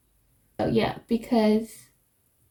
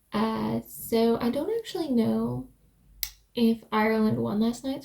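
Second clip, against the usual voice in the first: ordinary talk, nothing marked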